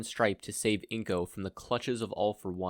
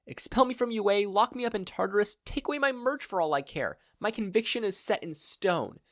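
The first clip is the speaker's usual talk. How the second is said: defiant declaration; 11 semitones higher than usual. A narrator's reading. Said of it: "Izuku declared".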